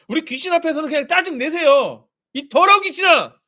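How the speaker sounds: loud, high shout at the demon; preacher shouting a rebuke at a demon; cursing rebuke. Shown as a demonstration. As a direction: drive the demon out with a yell. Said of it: "막 욕하면서 쫓아내시라고요".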